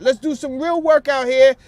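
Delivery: ordinary talk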